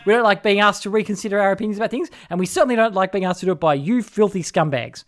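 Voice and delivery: high, loud mock-indignant rant; outraged conservatives. Conservatives snapping back at lefties, loud mock outrage. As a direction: rail at the lefties in a put-on outrage. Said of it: "that immediately makes conservatives go".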